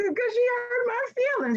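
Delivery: imitating her crying younger self; hurt teen self. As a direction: squeak it out like a hurt thirteen-year-old. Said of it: "And I'm crying now".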